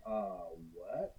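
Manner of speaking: low, quiet baffled reaction; stunned disbelief. Retold or imitated. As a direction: act out being dumbstruck, voice dropped. Retold stunned reaction to Welsh request.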